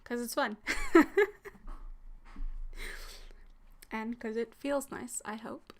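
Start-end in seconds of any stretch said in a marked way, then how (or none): 0.6-3.8 breathy whispered giggle. Real, not acted. Giggle after "'cause it's fun.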